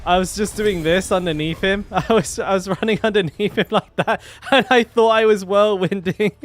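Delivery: cracking up as you say it; laughing. Real, not acted. Laughing at his own mistake.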